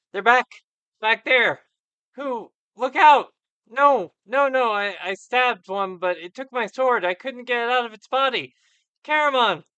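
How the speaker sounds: high, loud, panicked shouting; terrified madman. Acted. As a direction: yell in frantic terror at unseen attackers.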